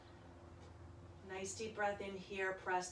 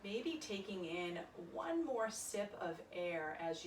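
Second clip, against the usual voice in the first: soft, calm yoga meditation voice; quiet guided meditation for a yoga class; guided relaxation; 2 semitones lower, 5 dB quieter than usual. Acted. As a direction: ease them into their breath gently.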